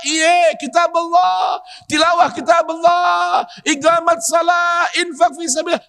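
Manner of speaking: high, ringing shout, slowed; fervent preaching shout. Real, not acted. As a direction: cry the list out with full force. Preacher stresses three duties. (seruan lantang penuh semangat)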